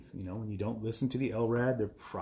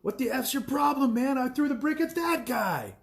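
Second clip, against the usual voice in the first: put-on high yelling drunk; 14 semitones higher, 3 dB louder than usual. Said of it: "He's like".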